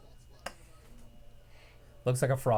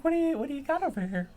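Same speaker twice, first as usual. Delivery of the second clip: high mock wrestler voice; faster than usual. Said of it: "He's like".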